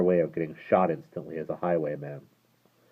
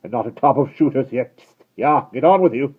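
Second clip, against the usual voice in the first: loud, higher driving cry; urging horses; 8 semitones higher, 10 dB louder than usual. Acted. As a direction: spur the horse on.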